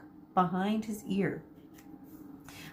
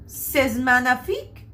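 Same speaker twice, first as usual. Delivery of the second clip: put-on light French accent, admiring; lightly voiced French hairdresser, louder; 5 semitones higher, 6 dB louder than usual. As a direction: savour it like a French stylist. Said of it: "said Monsieur".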